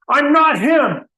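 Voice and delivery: angry, loud outburst, pitch jumps up. Acted. Fed-up man yelling at people who think he's Santa, angry and loud. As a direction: snap it out at the crowd, fed up. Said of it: "Oh, this makes me angry".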